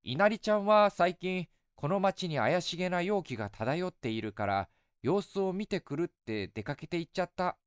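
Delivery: put-on light, coaxing young woman; goddess voice. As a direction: sweetly tease an old friend. Goddess speaks.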